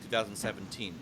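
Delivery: ordinary delivery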